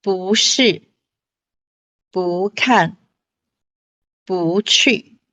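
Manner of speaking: 不是 不看 不去 each with 不 in a rising second tone; tone sandhi demo. Shown as a demonstration. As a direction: read 不是, 不看, 不去 with 不 rising: bú. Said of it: "They are all read at fourth tone".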